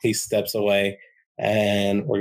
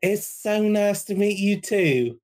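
put-on much higher, sweet gnome girl; little girl voice; 11 semitones higher than usual. Gnome girl greeting the newcomer, much higher sweet voice. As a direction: chirp a greeting up high. Yotina replies.

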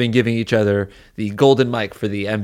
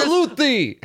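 mock soldier; slower than usual. Joining the military bit.

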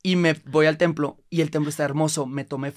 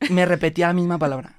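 ordinary talk

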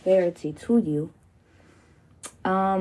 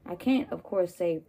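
usual speaking voice

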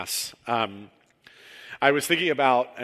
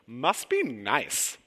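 slow, drawn-out envious must be nice; coworker's catchphrase; 3 dB louder, much slower than usual. Retold or imitated. Pastor mimicking a coworker's jealous catchphrase, slow and sour. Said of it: "the phrase was always said exactly like this".

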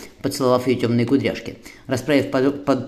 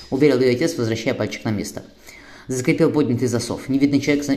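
ordinary delivery

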